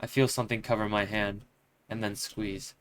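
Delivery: ordinary talk, nothing marked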